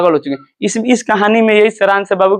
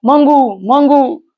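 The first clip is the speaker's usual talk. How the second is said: high-pitched mock cry for a lost daughter; imitated calling out; 7 semitones higher, slower than usual. Mother calls for Mangu at dawn, imitated high calling. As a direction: call out high, drawing out the name, without real shouting. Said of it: "ऐसे आवाज आने लगा".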